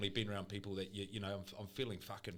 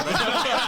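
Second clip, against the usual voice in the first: a loud, high burst of laughter; big laugh; 14 semitones higher, 14 dB louder than usual. Real, not acted. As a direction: crack up loudly at your own line. Bursts out laughing at own quote.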